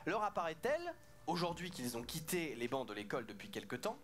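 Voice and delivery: quiet, even dictation read; soft dictation. Acted. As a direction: read it back softly. Rereading the whole sentence softly.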